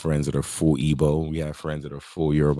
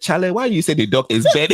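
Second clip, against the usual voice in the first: mock Ghanaian sister; 10 semitones higher, 4 dB louder than usual. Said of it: "she said".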